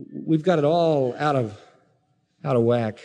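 ordinary delivery

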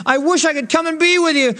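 high, pleading cry of helplessness; anguished plea. Acted. Paul pleading with his wayward church, anguished. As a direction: plead as Paul, torn and at a loss. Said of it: "but he says".

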